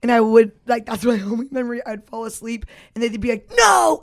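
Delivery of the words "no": sudden loud high "No!"; sharp shout. Retold or imitated. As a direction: jolt someone awake with a shout. Parents keeping kid awake.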